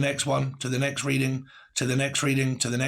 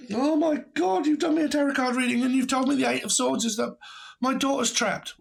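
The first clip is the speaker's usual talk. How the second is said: much higher and fast, hammed-up panic; mock panicked mother; 10 semitones higher than usual. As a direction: squeal it like a mocked hysterical mother. Mocking a panicked client.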